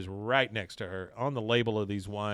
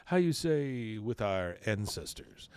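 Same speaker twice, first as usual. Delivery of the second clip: put-on slow Latin accent; slower than usual. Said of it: "He goes".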